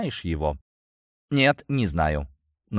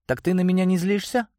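high, surprised female voice; surprised question, voice jumps up; 11 semitones higher than usual. Acted. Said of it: "Удивилась Вика".

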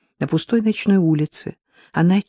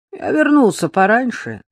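higher-pitched, slightly sleepy voice; lightly voiced drowsy young man on the phone; 7 semitones higher, 4 dB louder than usual. A narrator's reading. Brother answers her late call home, slightly sleepy. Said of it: "сказал он слегка сонным голосом".

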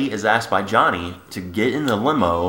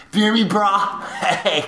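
laughing mock tough guy; 8 semitones higher, much slower than usual.

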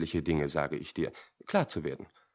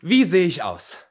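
higher and louder, keen to hear her verdict; eager question; 8 semitones higher, 10 dB louder than usual. Acted. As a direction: bring it out eagerly. Fragt eifrig nach seinem Aussehen. (eifrig, höher und lauter fragen)

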